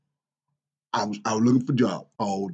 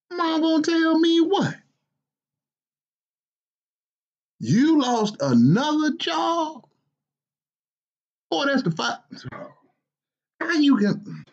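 a grumpy father's higher, exasperated voice; scolding dad. Acted. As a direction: scold your lazy grown son, incredulous. Father scolding his grown son.

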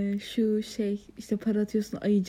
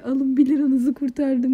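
excited retelling; 3 semitones higher, 7 dB louder, slower than usual. Real, not acted. Retelling attendant's remark, laughing.

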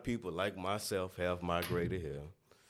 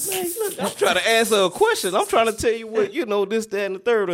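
much higher and louder, fed up; exasperated outburst; 12 semitones higher, 10 dB louder than usual. Retold or imitated. Student fed up with the teacher, shouting his answer, exasperated. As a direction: snap back, frustrated at being asked again. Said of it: "I'm like".

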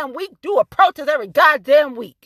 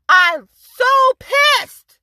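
very slow, higher and louder, stretching every word; dragged-out fury; 8 semitones higher, 4 dB louder, much slower than usual. Real, not acted. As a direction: draw out "I'm so pissed" slowly and loudly, pushing "pissed" down at the end.

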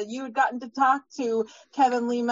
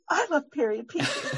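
gasp, then echo her words back with a giddy laugh; laughing, thrilled agreement; excited fangirl echo. Retold or imitated. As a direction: play the delighted fan finding a kindred spirit.

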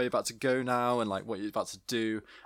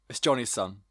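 ordinary delivery

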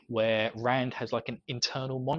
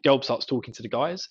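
normal talking voice

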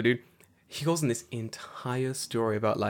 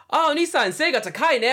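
high, loud cheery call-out; loud kid; 6 dB louder than usual. Retold or imitated. Bravest kid yelling to his new big friend, high and loud. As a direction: belt it like a bold little kid. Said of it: "like, loud as".

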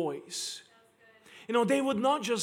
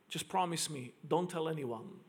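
hushed request; 4 semitones lower, 7 dB quieter than usual. Retold or imitated. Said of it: "He says".

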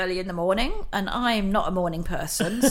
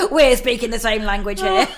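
laughing; 7 semitones higher, 9 dB louder than usual. Real, not acted. Laughing at the child's trump.

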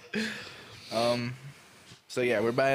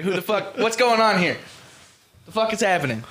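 higher, louder mock bewilderment; baffled outburst; 7 semitones higher, 7 dB louder than usual. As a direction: blurt it out in baffled protest. Acting out baffled alarm at mail.

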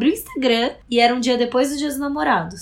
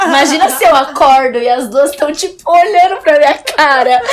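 loud, high laughing through words; 6 semitones higher, 10 dB louder than usual. Real, not acted. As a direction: crack up while picturing it. Laughing at the scene. (falando rindo alto)

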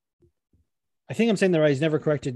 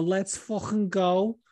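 slow Scouse hype line; Scouse trigger phrase; 5 semitones higher, slower than usual. Shown as a demonstration. Host demoing his other Scouse trigger phrase. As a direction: stretch it out in Liverpool style. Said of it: "with Scouse".

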